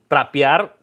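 trapear said as an anglicized Spanglish verb; Spanglish word. Shown as a demonstration. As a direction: say trapear the way he says it day to day. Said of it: "yo digo parquear".

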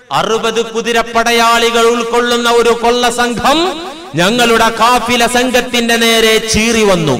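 sustained, ringing preacher's delivery; impassioned sermon storytelling. A narrator's reading. Preacher retelling a saint's farewell to his mother, impassioned. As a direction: preach the tale in a drawn, level chant.

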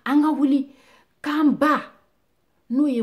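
very loud, high call; ringing, full-force exhortation; rallying cry. Acted. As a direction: shout the summons to the nation. Rallying call in recited poem.

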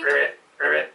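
frog croak. Acted. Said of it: "croak then".

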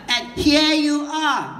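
slow and drawn out; over-complete phrasing, unhurried; slow wordy version. Retold or imitated. Mocking the wordy greeting, slow. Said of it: "No, it's too many words".

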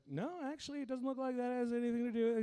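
high-pitched put-on doctor voice; high, even voice of a doctor on a call; imitating the doctor. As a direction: explain it as a doctor, pitched well up. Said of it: "And he's like".

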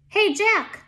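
high, loud call to Jack. A narrator's reading. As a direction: call out to someone at a distance. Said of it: "Annie called".

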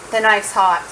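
ordinary talk, nothing marked

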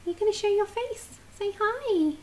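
high, soft cooing to a dog; soft, high pet voice; puppy talk. Real, not acted. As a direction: gently coax a shy pet in a tiny high voice. Coaxing the puppy to greet viewers.

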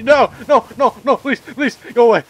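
loud, frantic begging; desperate pleading. Real, not acted. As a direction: beg them loudly to leave. Pleading as his base is raided.

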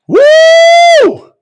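loud high-pitched long "woo"; joyful whoop. Real, not acted. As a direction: whoop out in real elation. Overcome preacher whoops for joy.

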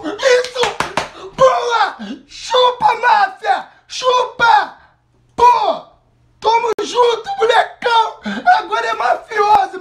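loud triumphant yelling; victory shouting. Real, not acted. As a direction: celebrate at full voice. Celebrating the win.

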